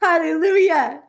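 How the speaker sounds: high, laughing, ecstatic "Hallelujah"; spirit-filled joy. Real, not acted. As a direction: burst out in overflowing praise. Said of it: "He's gonna start lighting me up".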